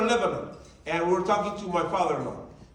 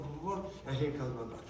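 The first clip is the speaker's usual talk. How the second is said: ordinary talk, nothing marked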